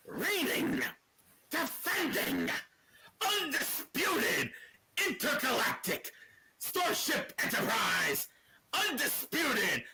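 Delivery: high, slow, booming hype intro; announcer parody. Pretend. Mocking Roman Reigns's endless title, slow high announcer shout. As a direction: drag out every title like a ring announcer milking the crowd.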